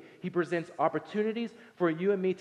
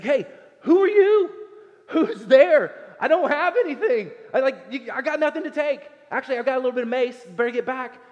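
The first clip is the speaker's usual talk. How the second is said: much higher, louder, frantic and laughing; a jumpy man blurting out in a fright; hammed-up terrified sleeper shouting in the dark; panicked startled man; 9 semitones higher, 10 dB louder than usual. Boaz acted startled awake.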